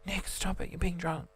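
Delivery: hushed scolding whisper. Retold or imitated. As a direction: hiss it out quietly. Hushing brother near dad.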